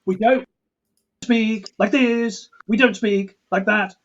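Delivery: "We don't speak like this" with every word weighted and spaced out; Eastern European rhythm. Shown as a demonstration. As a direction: hammer each syllable evenly. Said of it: "sort of rhythm you might get".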